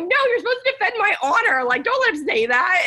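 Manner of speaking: high-pitched, playful indignation; mock-indignant protest. Nurse to male colleague cracking up at her, indignant. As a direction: plead high for backup, half amused.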